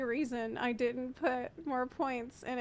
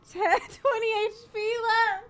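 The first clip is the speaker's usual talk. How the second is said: laughing; 7 semitones higher, 4 dB louder than usual. Real, not acted. Laughing about the low HP.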